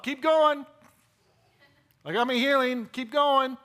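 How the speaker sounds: high, loud urgent plea to keep moving; pleading woman. Retold or imitated. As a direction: beg him not to stop, frantic. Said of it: "She doesn't want him to stop".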